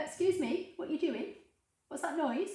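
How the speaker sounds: high, soft, singsong questioning of a dog; talking to pet. Real, not acted. Host asks dog what that noise is, high pet-talk.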